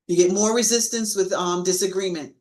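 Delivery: ordinary delivery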